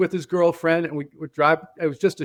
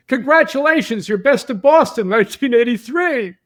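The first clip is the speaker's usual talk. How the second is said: pitched high and louder, celebrating; excited announcement; 7 semitones higher, 5 dB louder than usual. Retold or imitated. Magazine girls phoning to announce his award, excitedly. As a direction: shout the news like a prize win.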